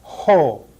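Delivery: Ho with a clear breathy h before o; Ho, stress the h sound; Ho, rough breathing shown. Shown as a demonstration. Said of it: "putting an H sound in front of the vowel".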